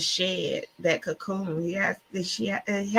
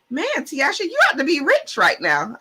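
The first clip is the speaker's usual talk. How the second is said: imitating an excited neighbor, high-pitched; high, animated retold exclamation; impressed neighbor; 9 semitones higher, 4 dB louder than usual. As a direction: voice a neighbor who's loudly impressed. Neighbors coming over impressed.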